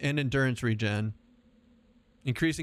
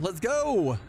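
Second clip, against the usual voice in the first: higher, excited go-call; hyped rallying cry; 6 semitones higher than usual. Real, not acted. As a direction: whoop it as you charge in. Hyped to fight again.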